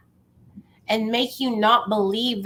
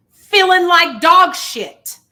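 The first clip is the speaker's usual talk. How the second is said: loud, slow and emphatic; 7 semitones higher, 8 dB louder, slower than usual. Real, not acted. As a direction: lean hard on every word. Stressing the comedown.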